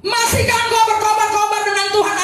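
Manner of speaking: high-pitched, forceful preaching cry; fired-up challenge. Real, not acted. Preacher challenging the congregation about their fire, fired-up. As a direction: throw the question out with full fire. Same question repeated at full force.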